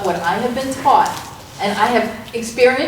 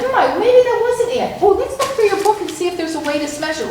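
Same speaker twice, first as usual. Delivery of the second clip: put-on higher, quicker child voice; child's voice; 8 semitones higher than usual. Coach retelling a child's reply, in a higher, quicker child voice. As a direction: play the kid thinking it over, light and fast. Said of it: "And then the child said".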